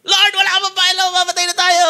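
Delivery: high desperate cry; panicked shout. Acted. Peter yells to Jesus in the storm, panicked. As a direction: call out in panic over the waves. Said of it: "sino sumigaw niyan".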